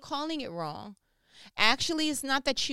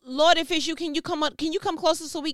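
higher, fast, hurried nervous asking; mock nervous request; 5 semitones higher, 7 dB louder, faster than usual. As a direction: rush it like you're scared. Mock timid disciple asking.

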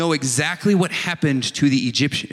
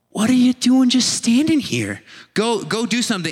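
higher, pushy God voice; urging God; 6 semitones higher, 3 dB louder than usual. Retold or imitated. God prodding someone standing still, mildly impatient. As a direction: shove them into action.

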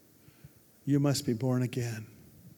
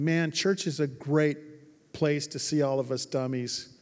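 ordinary delivery

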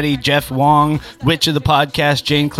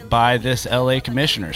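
ordinary talk

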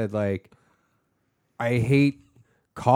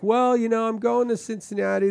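much higher retold voice; himself answering dad; 10 semitones higher than usual. Son trying to tell dad his plans, higher retold voice. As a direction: raise the pitch, replaying your side. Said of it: "I'll be like".

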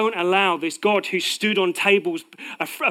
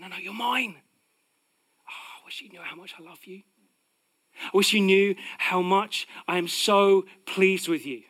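tender God voice. Acted. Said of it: "this God ... saying".

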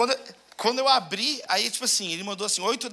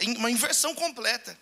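ordinary talk

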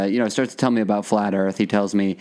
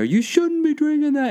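mocking the stranger's voice. The stranger's warning again.

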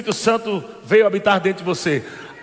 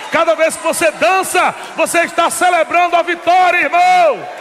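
preaching climax; 5 semitones higher than usual. Acted. Preacher hailing his brother in the pews, ringing out.

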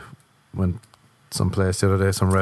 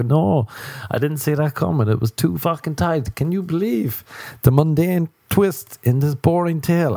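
raised-pitch sarcastic disbelief; hammed-up wonder, higher voice; mock astonishment; 7 semitones higher than usual. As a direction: act floored by a trivial reveal. Mocks listener stunned by dull twist.

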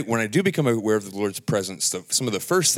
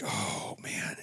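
hushed, breathless wonder; awed whisper; 10 dB quieter than usual. Retold or imitated. Sensing God's presence, a whispered awe. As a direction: whisper it like you just felt his presence. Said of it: "and just like".

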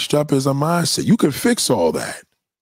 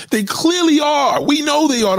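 emphatic shout; 12 semitones higher than usual. Real, not acted. Ranting about hoarders in denial.

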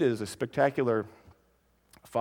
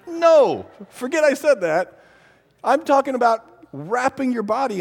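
emphatic correction; 9 semitones higher, 7 dB louder than usual. Real, not acted. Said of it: "correcting the donut mixup".

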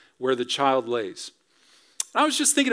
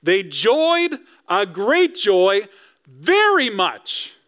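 over-the-top joy; 4 semitones higher, much slower than usual. Shown as a demonstration. Preacher acts out the wise men's joy, over-the-top delighted. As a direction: burst with Elf-like glee. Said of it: "It's like Elf with Will Ferrell".

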